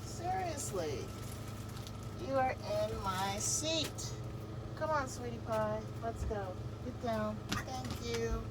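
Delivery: coaxing pet. Real, not acted.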